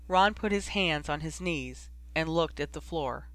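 ordinary delivery, nothing marked

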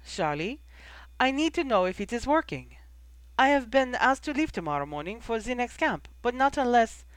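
put-on high, formal young woman's voice; insistent girl. Girl character appeals to Charlie.

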